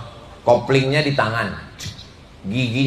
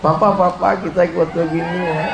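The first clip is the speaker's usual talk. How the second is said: higher, coaxing wife asking husband; sweet wife; 4 semitones higher than usual. Retold or imitated. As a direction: coax papa sweetly. Wife asks husband for photo.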